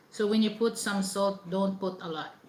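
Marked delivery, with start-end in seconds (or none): none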